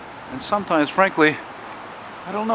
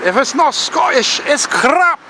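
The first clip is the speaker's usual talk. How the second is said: mock Scottish; 12 semitones higher, slower than usual. Quoting Scottish catchphrase, put-on voice.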